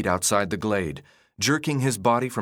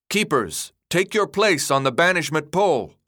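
raised, formal command; projected ceremonial order; loud, ceremonious; 6 semitones higher, 4 dB louder than usual. A narrator's reading. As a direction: call the officials to their posts. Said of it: "Albie spoke in a loud, almost ceremonious voice".

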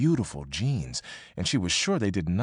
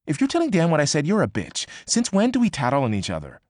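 higher, fast and snippy; 7 semitones higher, 4 dB louder, faster than usual. Acted. Annie's text accusing May of telling Dan, higher and fast. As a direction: accuse her of tattling.

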